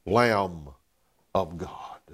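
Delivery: breathy whisper of awe; sudden hushed whisper at the phrase end. Acted. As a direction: drop it to a whisper, reverent. Preacher drops to hushed climax.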